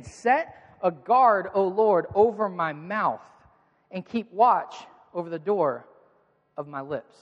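very slow and deliberate, each phrase weighted; drawn-out psalm recitation; slow emphatic scripture reading. Shown as a demonstration. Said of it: "look at what he says again".

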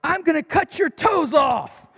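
mock yell of a furious little boy, slowed for effect; yelling child. Son threatening his mother, imitated angry yell. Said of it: "he yells at her".

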